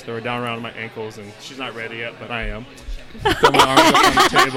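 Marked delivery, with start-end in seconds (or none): 3.2-4.6 high, loud laugh through the line; cracking up while telling it; real laughter bursting out. Real, not acted. Laughing at his own story.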